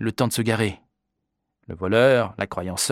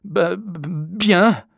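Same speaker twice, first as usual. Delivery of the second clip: high, stuttered answer through chattering cold; shivering stammer; 8 semitones higher, 3 dB louder, much slower than usual. A narrator's reading. Soaked boy answering the man, shivering stammer. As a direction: stammer as if freezing. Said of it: "Je grelottai".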